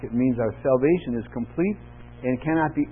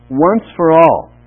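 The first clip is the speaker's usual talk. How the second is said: once for all drawn out slowly and loud; stress on once; 2 semitones higher, 12 dB louder, slower than usual. Shown as a demonstration. Pastor stressing once for all to the congregation, slow and loud. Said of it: "Notice the emphasis on the words once".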